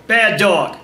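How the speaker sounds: loud, flat, emotionless command; firm and loud, without getting worked up; bad dog voice. Shown as a demonstration. Owner scolding a dog that peed, flat bad dog voice. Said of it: "Kind of, kind of flat, kind of emotionless".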